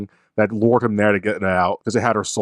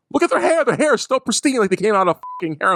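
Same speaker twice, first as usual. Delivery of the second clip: much higher, scoffing at the hair; incredulous mockery; 10 semitones higher than usual. Real, not acted. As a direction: scoff in disbelief, pitched up. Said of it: "mocking complaint".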